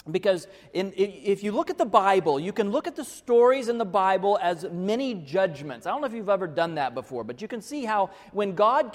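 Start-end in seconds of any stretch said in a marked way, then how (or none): none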